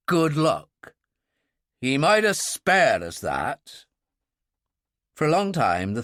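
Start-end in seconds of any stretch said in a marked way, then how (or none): 0.1-3.9 a wry, bitter repeat of good luck; bitter echo. Acted. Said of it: "his mouth twisted".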